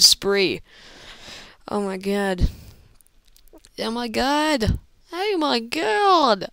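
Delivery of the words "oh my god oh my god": drawn-out Australian put-on, rising higher; poor Australian accent. Gamer playing around mid-match, hammy Australian accent. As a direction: stretch it slowly in a mock Australian accent. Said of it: "Talking an Australian accent".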